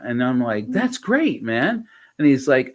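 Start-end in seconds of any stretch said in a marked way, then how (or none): 0.7-1.9 high, bright approval; enthusiastic praise. Retold or imitated. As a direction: cheer him on. Praising his vaccination.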